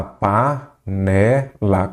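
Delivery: "Panela" stretched out very slowly, syllable by syllable; teacher spelling out "Panela" slowly; slow syllable demo. Shown as a demonstration. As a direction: sound out "Panela" so students find the stressed syllable. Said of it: "Qual que é a sílaba tônica?".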